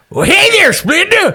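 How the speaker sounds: put-on high, hooting old prospector voice. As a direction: holler it like a grizzled old miner. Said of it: "he's an old prospector".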